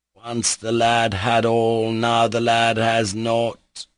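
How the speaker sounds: slow, proclaimed rhyme; declaiming verse. Acted. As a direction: cry it out like a ballad. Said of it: "cried Mitya suddenly".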